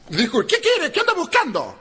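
high shrieking yell from above; angry woman yelling. Retold or imitated. Angry woman shouting down at the drunk, high yell. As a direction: scream it down from the balcony. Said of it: "Lo grita de arriba".

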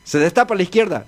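normal talking voice

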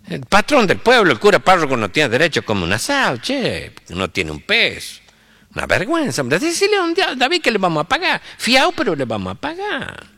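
high, lilting rural grumble swooping upward; aggrieved sing-song sierra priest; indignant country priest. Acted. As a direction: complain like a hard-up village priest, then plead high. Indignant priest complains, sends sacristan.

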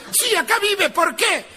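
high, sharp mock woman; lightly voiced cranky woman; high woman's voice. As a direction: snap back from the balcony. Woman answering from balcony.

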